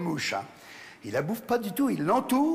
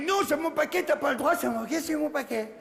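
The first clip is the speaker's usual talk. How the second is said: high-pitched, hammed-up whining protest; play-acted protest; 7 semitones higher than usual. Little male spider pretending to fight for his parcel, play-acted protest. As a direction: overact clinging to your parcel. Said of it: "on sent qu'il joue la comédie".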